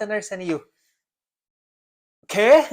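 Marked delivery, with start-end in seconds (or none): 2.2-2.7 put-on higher, louder questioning Portuguese accent; mock Portuguese accent. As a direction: play a puzzled listener with a Portuguese accent. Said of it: "With the Portuguese accent".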